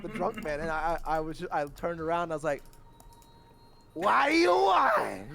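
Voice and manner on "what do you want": high, loud, slow shout; yelled "what do you want". Retold or imitated. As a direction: snap back loudly at the drunk guy. Drunk man interrupts dishwasher.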